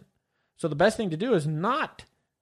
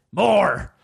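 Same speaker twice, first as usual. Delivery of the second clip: loud, hammed-up demand; mock bloodthirsty yell; 5 semitones higher, 9 dB louder than usual. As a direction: yell it like a fake fanatic. Mocking bloodthirsty fans of pain.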